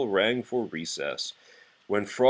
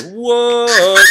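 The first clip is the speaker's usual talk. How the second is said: high loud startled yelp; startled what; 13 semitones higher, 12 dB louder than usual. Acted. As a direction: jolt awake with a yelp. Froggy startled awake.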